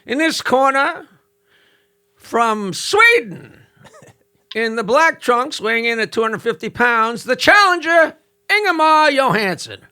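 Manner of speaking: high, booming ring announcer voice; boxing ring announcer. Retold or imitated. Comic telling a roast joke, voicing the ring announcer. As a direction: call it like a ringside announcer. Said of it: "the announcer comes out and says".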